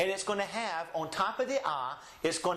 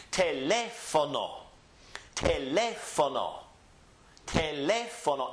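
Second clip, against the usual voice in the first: Teléfono with LÉ raised, fo-no dropping after; stress on LÉ; 2 semitones lower than usual. Shown as a demonstration. Teacher modelling a word for students, raised stressed LÉ. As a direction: punch up the LÉ in Teléfono, then fall away. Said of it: "so we must raise our voice here".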